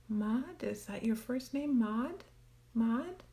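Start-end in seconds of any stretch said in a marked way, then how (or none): none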